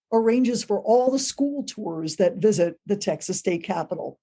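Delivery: ordinary talk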